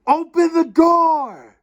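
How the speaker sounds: high, drawn-out shout; loud, long yell to be let in. A narrator's reading. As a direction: yell it full out, stretching the words. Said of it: "started yelling".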